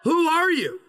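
ordinary talk, nothing marked

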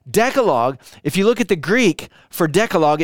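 usual speaking voice

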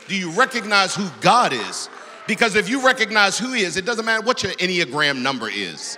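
preaching build. Acted. Preacher pressing the congregation, loud and high. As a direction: drive the question home.

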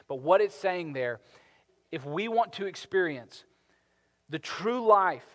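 loud, urgent sermon delivery, slowing on key words; impassioned preaching. Acted. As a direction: preach it with fire to the congregation. Preaching at full force.

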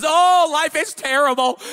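high, loud, slow wail; exaggerated little-kid lament; mock despair. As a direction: ham up a child's melodrama. Mimics children's overblown feelings.

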